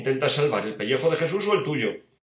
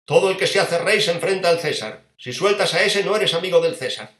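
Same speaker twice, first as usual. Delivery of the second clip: loud, higher menacing warning; threatening crowd; 5 semitones higher, 5 dB louder than usual. Acted. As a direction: threaten him with Caesar.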